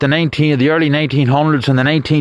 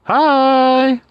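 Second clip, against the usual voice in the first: excited greeting; 10 semitones higher, much slower than usual. Real, not acted. Cheery greeting to stream viewers.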